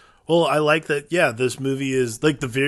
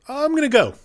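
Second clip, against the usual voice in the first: lightly voiced, pitched up high and breezy; much higher casual quote; 10 semitones higher, faster than usual. Hero casually announcing he's leaving, pitched up high. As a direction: toss it off high like it's no big deal. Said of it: "he literally just stands and goes".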